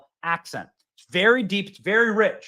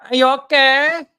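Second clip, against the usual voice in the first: higher, louder "okay?" with a rising end; mock concern; 6 semitones higher, 5 dB louder than usual. Shown as a demonstration. Host mocking the clip, asking again with mock concern. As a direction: ask it again in exaggerated fake concern. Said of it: "repeated question".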